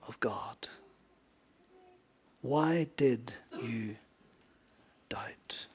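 hushed whisper, slow. Acted. Preacher repeating Jesus' question: hushed whisper. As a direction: let it fall to a breath.

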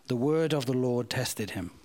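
usual speaking voice

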